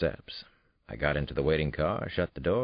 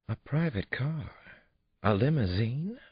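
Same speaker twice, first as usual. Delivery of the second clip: exclaiming in surprise; 10 semitones higher than usual. A narrator's reading. Christine sees the limousine her date sent, delighted surprise. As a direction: marvel at the car. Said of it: "Christine exclaimed".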